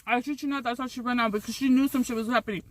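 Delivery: ordinary talk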